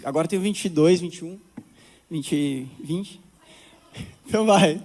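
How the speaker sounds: normal talking voice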